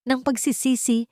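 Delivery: ordinary delivery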